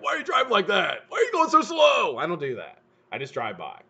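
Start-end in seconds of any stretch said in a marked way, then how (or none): 0.0-2.2 high, loud sneering driver yell; mock angry yell.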